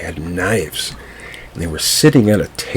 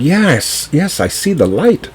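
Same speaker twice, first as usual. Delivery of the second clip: Peter's voice; 9 semitones higher than usual. A narrator's reading. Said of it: "he said".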